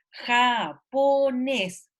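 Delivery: japonés muy lento, sílaba por sílaba; japonés estirado. Shown as a demonstration. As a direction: alarga cada sílaba de japonés para buscar la tónica. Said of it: "ellos tienen que decírselo". (japonés silabeado lento)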